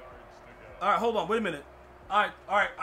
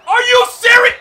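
screamed, much higher and louder; disbelieving shout; 20 dB louder than usual. Real, not acted. Gamer yelling at the video game, stunned furious shout. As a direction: blow up in outrage at the screen.

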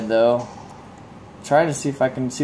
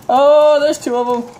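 alarmed cry; 12 semitones higher, 5 dB louder than usual. Real, not acted. Chased by withers.

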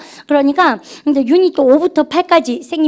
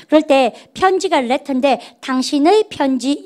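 ordinary delivery

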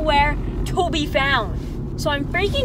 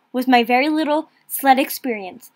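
ordinary talk, nothing marked

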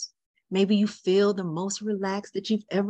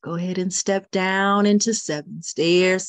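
ordinary delivery

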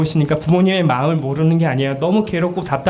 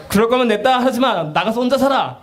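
higher, mock-exasperated scolding; mock angry parent; 8 semitones higher than usual. Parent scolding child over homework, mock anger. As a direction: throw the kid out, half-acted.

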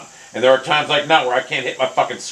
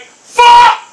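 very high, loud explosive curse; enraged scream; 11 dB louder than usual. Real, not acted. Gamer cursing after he fell apart, enraged scream. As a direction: scream out the frustration.

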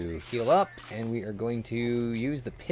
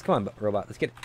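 ordinary talk, nothing marked

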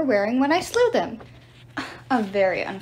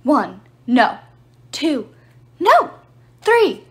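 rising pitch and volume, each number louder; urgent countdown; 3 semitones higher, 6 dB louder, much slower than usual. Acted. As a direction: count it down, climbing to three. Whispered countdown before the jump.

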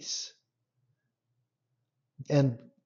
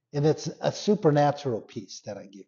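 ordinary talk

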